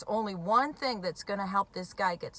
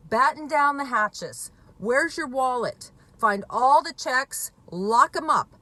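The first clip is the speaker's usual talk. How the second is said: louder, urgent, slowed-down orders; urgent warning; 4 semitones higher, 6 dB louder than usual. Retold or imitated. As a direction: bark out a retold alarm to a worried mother. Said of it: "I told her".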